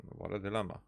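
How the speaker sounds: normal talking voice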